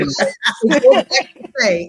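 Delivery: words pushed out through a high laugh. Real, not acted. Laughing at sister's remark.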